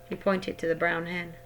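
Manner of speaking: usual speaking voice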